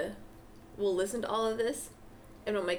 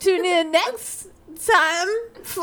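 slow, louder announcer voice; announcer sign-off; 7 semitones higher, 9 dB louder, much slower than usual. Pretend. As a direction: sign off like a radio host. Mock sign-off to end podcast.

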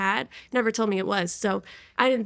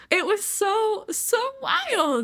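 soaring much higher on so wild; amazed; 10 semitones higher, much slower than usual. Real, not acted. Looking back on tarot cards, amazed and rising high. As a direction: marvel at how crazy it was. Marvelling at her past self.

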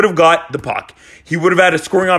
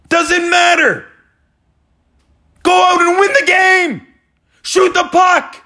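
screaming, yelling; 7 semitones higher than usual. Real, not acted. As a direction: yell orders at your losing team. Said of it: "I'm screaming. I'm yelling".

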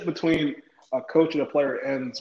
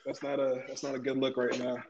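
ordinary talk

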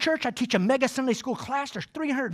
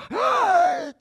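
sudden gasp, squeaking up high; a startled intake of breath; sharp high gasp. Retold or imitated. Teacher caught by churchgoer, high gasp. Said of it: "and I go".

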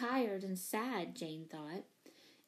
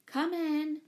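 higher-pitched call to the door; 6 semitones higher, 3 dB louder than usual. A narrator's reading. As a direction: call a visitor in. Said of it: "and called".

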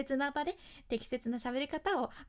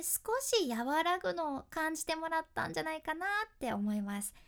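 usual speaking voice

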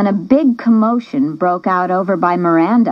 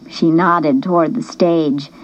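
ordinary delivery

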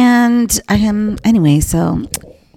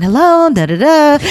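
higher, louder phone hello; retold composed phone greeting; 6 semitones higher, 5 dB louder than usual. Answering a call while housemate yells, mock-composed greeting. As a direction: imitate answering while holding back laughter. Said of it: "trying not to laugh".

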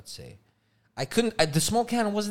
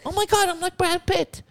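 mock starstruck kid; 14 semitones higher than usual. Mock kid comparing to celebrity.

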